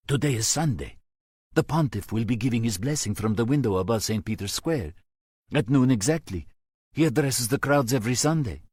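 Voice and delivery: priest suddenly grasping the danger; alarmed realisation. A narrator's reading. Max realises the threat.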